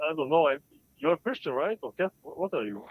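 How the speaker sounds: normal talking voice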